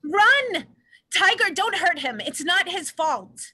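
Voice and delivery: loud frantic warning; urgent shout. Acted. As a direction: scream at them to run, then plead. Warning others, begging Tiger.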